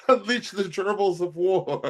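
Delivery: high, loud silly war cry; mock battle cry. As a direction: shout it like a hammy general.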